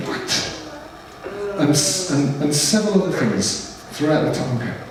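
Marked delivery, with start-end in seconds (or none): 0.1-1.6 stunned what. Retold or imitated. Sudden realization, shock.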